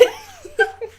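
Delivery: high shrieking laugh; helpless high laugh. Real, not acted. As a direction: burst into a wild cackle. Big laugh.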